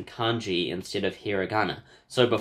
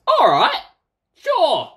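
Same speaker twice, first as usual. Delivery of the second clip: high, loud burst of glee; excited squeal; 9 dB louder, much slower than usual. Real, not acted. Reacting to a new clue, high excited yelp. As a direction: yelp it out happily.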